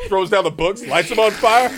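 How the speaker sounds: ordinary talk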